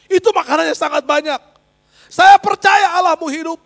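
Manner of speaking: full-voiced, high-pitched yelling; retold shouting. Classmate declaring he now believes, shouting. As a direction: yell it as a stunned new believer. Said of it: "Sambil dia teriak-teriak".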